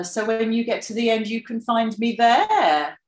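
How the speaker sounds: mock emphatic map-task instruction; emphatic rise-fall. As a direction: stress the end point with a rise-fall. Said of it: "one of the speakers says".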